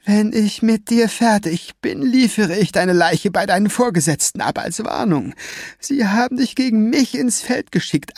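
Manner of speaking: high, strained, sneering thug voice; villain's rant. A narrator's reading. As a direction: taunt her from high in the throat. Villain threatens her.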